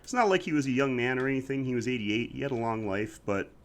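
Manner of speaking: ordinary delivery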